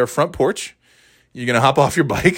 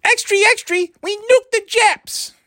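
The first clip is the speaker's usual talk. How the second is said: very high-pitched period newsboy cry; old-time newsie. Acted. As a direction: hawk papers like a hammed-up newsboy. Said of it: "that's how they talked".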